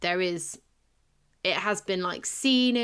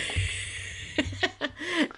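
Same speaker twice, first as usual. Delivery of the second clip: laughing; 9 semitones higher, 4 dB quieter than usual. Real, not acted. Laugh after loud remark.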